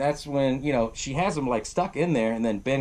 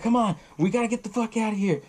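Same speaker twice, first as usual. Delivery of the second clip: retold urgent plea; 8 semitones higher than usual. Retold urging friends to flee.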